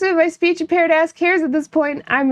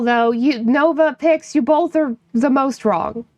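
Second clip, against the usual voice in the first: ordinary talk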